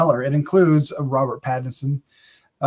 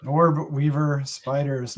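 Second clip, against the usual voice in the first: ordinary delivery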